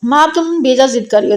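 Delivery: usual speaking voice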